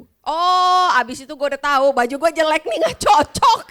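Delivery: high, loud laughing realization. Real, not acted. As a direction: crack up while admitting it. Laughing reaction to husband. (tertawa keras dengan suara tinggi)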